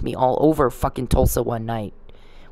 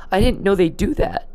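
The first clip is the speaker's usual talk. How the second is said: higher-pitched, choked-up imitation of a crying coworker; tearful retelling; 6 semitones higher than usual. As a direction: retell her words as if close to tears. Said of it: "she starts crying".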